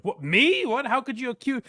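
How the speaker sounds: high, fake-innocent protest; mock innocence. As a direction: play dumb, wide-eyed. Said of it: "they pretend like".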